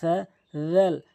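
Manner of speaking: সা and রাল said slowly with the same tongue-between-teeth sound; letter demonstration. Shown as a demonstration. Teacher showing students that both letters sound the same, slow. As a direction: drag out সা and রাল alike. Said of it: "সার মতো উচ্চারণ হচ্ছে".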